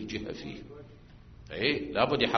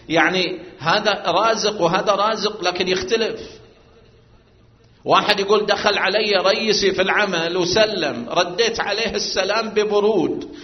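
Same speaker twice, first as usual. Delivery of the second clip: raised, louder preacher's voice; preaching at full force; 7 semitones higher, 8 dB louder than usual. Acted. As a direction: drive the point home to the congregation. Preaching an example aloud.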